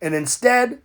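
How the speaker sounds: ordinary delivery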